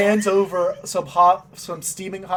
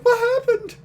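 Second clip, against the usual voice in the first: much higher, startled what happened; startled shout; faster than usual. Acted. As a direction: cry it out in shock. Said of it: "it's like".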